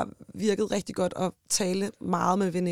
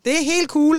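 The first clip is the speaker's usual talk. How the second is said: imitating her own strained, higher, louder reassurance; telling her ex it's fine in an odd high, louder voice; weird high voice; 7 semitones higher, 6 dB louder than usual. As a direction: squeak it out awkwardly high. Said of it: "snakker med sådan en underlig høj stemme".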